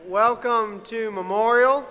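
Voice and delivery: bright, loud church welcome; upbeat youth pastor. Real, not acted. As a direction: greet the congregation with energy. Pastor greeting the congregation.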